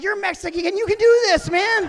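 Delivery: high, loud self pep talk; psyching himself up. Retold or imitated. Rider telling himself he can do this, psyched up. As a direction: hype yourself up, pitch climbing.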